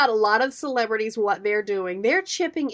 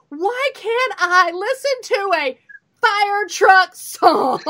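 mock outburst; 7 semitones higher, 4 dB louder, much slower than usual. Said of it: "I just look at him and go".

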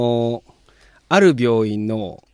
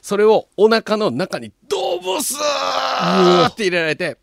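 dramatic build; 6 semitones higher, slower than usual. Retold or imitated. Describing needle thrust.

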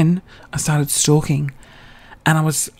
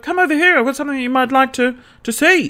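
imitating a promoter's pitch; 9 semitones higher than usual. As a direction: mimic an eager promoter in a high voice. Imitating Andrew luring her over.

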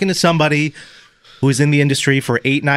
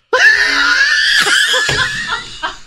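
screaming laugh. Real, not acted. Host erupts laughing at own story.

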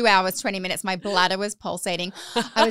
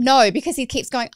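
ordinary talk

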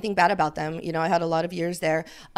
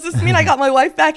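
repeated high and loud through laughter; retelling her husband's joke, high and laughing; 9 semitones higher, 10 dB louder than usual. Retold or imitated. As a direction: laugh while quoting him. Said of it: "He goes".

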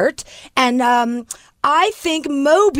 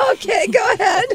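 giggling through the words; can barely get it out for laughing; breathless laughing go-ahead; 9 semitones higher than usual. Real, not acted. Cracking up after producer exchange.

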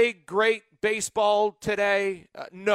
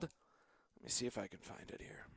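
very soft, low, whispery mumble; muttered mock aside; 11 semitones lower, 23 dB quieter than usual. Host pretends to hunt for an unfamiliar term, muttering. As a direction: mumble to yourself while pretending to look it up.

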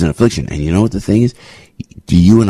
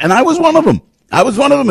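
high confessional; faster than usual. Real, not acted. Confessing he attacked Reagan.

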